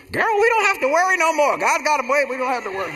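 put-on high, excited mother telling her girlfriend; mama on the phone. Mother tells her girlfriend on the phone she doesn't worry, high excited voice. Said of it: "I heard her".

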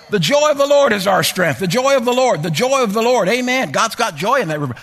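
loud, rising, shouted preaching; preaching at full force. Acted. Preacher stirring the congregation to shout, loud and high. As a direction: build it up to a joyful shout.

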